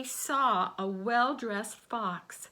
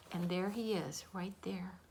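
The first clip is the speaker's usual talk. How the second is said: whisper; 2 semitones lower, 7 dB quieter than usual. Real, not acted. Aside whisper to children.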